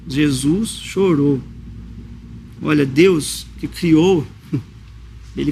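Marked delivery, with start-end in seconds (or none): 0.1-1.4 solemn slow. Acted. Repeats key verse solemnly.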